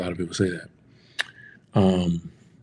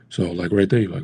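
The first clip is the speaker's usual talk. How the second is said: normal talking voice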